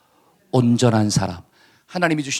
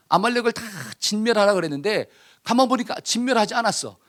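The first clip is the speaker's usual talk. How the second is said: ordinary talk, nothing marked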